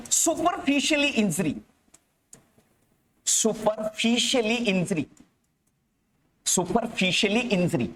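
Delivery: stretched syllables for note-takers; measured, drawn-out classroom dictation; slow repeated dictation. Real, not acted. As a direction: dictate the term slowly, syllable by syllable. Repeats term slowly as students write.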